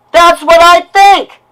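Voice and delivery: real rage, each word pounded; furious shout. Real, not acted. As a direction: yell it, shaking with anger. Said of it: "what you just heard is a lash out".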